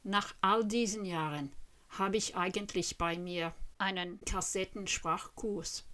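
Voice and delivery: ordinary talk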